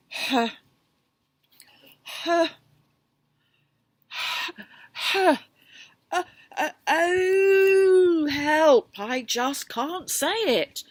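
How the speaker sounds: loud, high laughing gasps; breathless rhino laughing; helpless laughter. Acted. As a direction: laugh so hard words barely come. Rhino laughing, can't speak.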